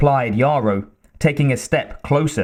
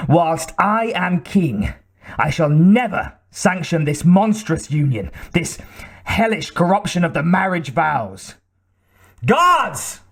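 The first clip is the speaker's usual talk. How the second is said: the king refusing, then calling his guards; furious king; 5 semitones higher than usual. A narrator's reading. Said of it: "Quivering with fury".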